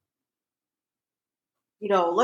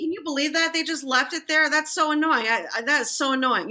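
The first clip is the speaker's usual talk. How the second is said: high, exaggerated commiserating; mock sympathy; 6 semitones higher than usual. Showing how to agree with an angry neighbor, mock sympathy. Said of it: "demo of agreeing".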